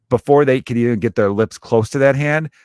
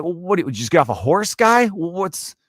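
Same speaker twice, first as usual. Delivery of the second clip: high-pitched incredulous challenge; baffled, stumbling over the question; mock disbelief; 8 semitones higher than usual. Bystander heckling a man who kisses a hand, incredulous.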